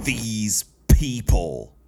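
drawn-out, disgusted mimicry. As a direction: stretch both words out in mock disgust. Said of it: "listen to the disgusted".

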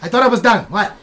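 grumbling rat, thought he was done; a touch of the pushy New York rat; lightly voiced wiseguy rat. Rat NPC called back.

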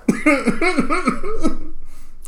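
high, real laughter; high rolling giggle; giggling fit. Real, not acted. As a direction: laugh helplessly at your own joke. Still laughing at the crude line.

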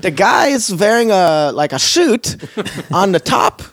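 overly friendly Swedish driver in the shower; Bjorn's Swedish accent. Retold or imitated. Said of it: "can we do the accent?".

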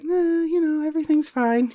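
a high, carefree mask over panic; faking being fine. Pretend. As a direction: pretend everything's fine in a high, airy voice. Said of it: "So, you know, it's like".

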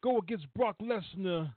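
playful imitation in a Red Hook accent. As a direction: tell it like a Red Hook wrestler. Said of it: "That's my Red Hook accent".

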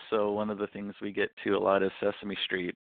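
usual speaking voice

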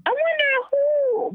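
high, slow wondering; musing. Real, not acted. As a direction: draw out the wondering. Musing, voice rises.

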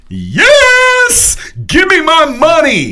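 high, loud jubilant shout; excited yell. Retold or imitated. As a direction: cheer like you just won big. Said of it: "and was like".

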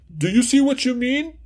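student asking in a high voice. Acted. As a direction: raise the pitch for the pupil. Acted student line, then corrected.

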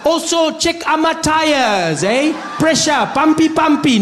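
high, loud, drawn-out fake African accent; crude, hammed-up African accent at full volume; mock African accent. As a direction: holler in a condescending put-on African accent.

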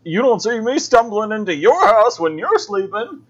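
put-on high, loud cranky old man voice; grumpy old codger yelling; old prospector. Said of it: "He's like".

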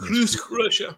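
Krusk said the Russian way, rolled and high; Russian accent. Shown as a demonstration. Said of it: "he says it with the Russian accent".

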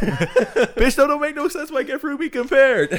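high squeal through laughter; mock-indignant while cracking up; laughing at a silly fruit joke, high and loud; laughing outrage.

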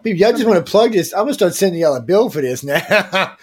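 host teases viewers for free plugs; mock exasperation. Real, not acted. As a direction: scold the chat with a grin. Viewers plugging their companies.

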